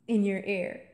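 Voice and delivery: ordinary delivery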